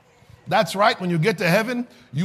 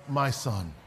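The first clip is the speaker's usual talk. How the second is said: very low and soft, almost reverent; a hushed, tender reveal after the question; hushed answer; 8 semitones lower, 8 dB quieter than usual. Acted. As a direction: drop right down and breathe the answer. Answering his own question softly.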